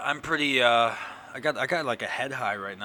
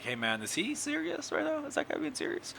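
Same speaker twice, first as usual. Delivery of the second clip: mock-baffled, pitched way up; incredulous high voice. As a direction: play someone stunned and disbelieving. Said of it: "other times it's like".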